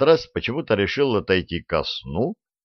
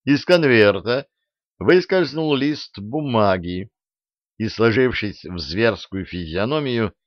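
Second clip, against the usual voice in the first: usual speaking voice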